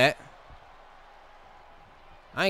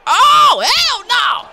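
huge high outraged yell of hell no; outraged shout; 13 dB louder, much slower than usual. Real, not acted. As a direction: scream it out in disbelief. Opponent taunts, player erupts.

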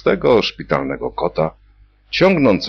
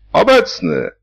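high, loud call of present; patient pops up and calls out; 5 dB louder than usual. A narrator's reading. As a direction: shout it like at roll call. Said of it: "zawołał pacjent".

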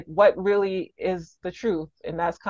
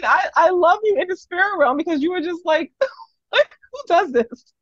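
excited laughing; 11 semitones higher, 5 dB louder than usual. Real, not acted. Laughing, excited reaction to story.

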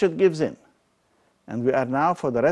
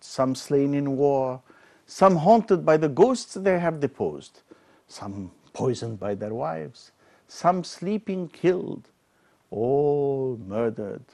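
declaimed Shakespeare, rising and falling with feeling; dramatic verse reading; 3 semitones higher, 5 dB louder than usual. Acted. Lecturer to students, reading King Richard's lament with dramatic weight. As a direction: give the speech its full tragic weight. Reads Richard's speech aloud.

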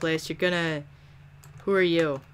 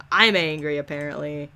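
ordinary delivery

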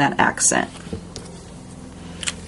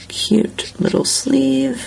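higher, slow and cutesy; cooing at the little sleeve, higher and slow; cutesy admiring; 9 semitones higher, much slower than usual. Real, not acted. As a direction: coo over it, drawn out slow. Admiring the coloring.

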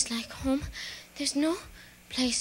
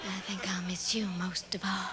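soft whisper; whispered farewell; 9 semitones lower, 9 dB quieter than usual. Acted. Dorothy whispers goodbye to the Scarecrow, soft. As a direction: breathe it to him tenderly.

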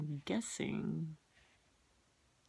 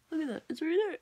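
excited pointing; 11 semitones higher, 7 dB louder, faster than usual. Real, not acted. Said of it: "look at this".

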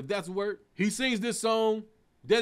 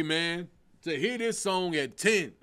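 normal talking voice

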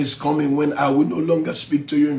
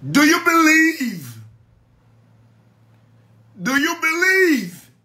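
much higher, loud, long sustained question; dramatic Jesus voice; 14 semitones higher, 3 dB louder than usual. Acted. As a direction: cry it out as Jesus.

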